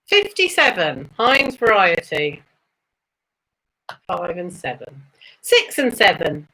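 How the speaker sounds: sing-song bingo number calls, rising then falling; bingo caller. Acted. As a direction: call each number like a bingo hall host.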